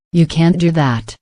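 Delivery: ordinary talk, nothing marked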